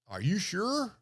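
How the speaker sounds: high, loud mimicry of a suspicious official; interrogating governor. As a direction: imitate an old governor grilling an applicant. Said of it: "and he says".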